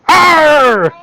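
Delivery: high, loud pirate growl; pirate arr. Acted. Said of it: "Breaks out of his pirate voice".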